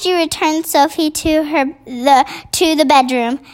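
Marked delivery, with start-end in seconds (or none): none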